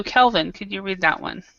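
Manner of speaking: ordinary delivery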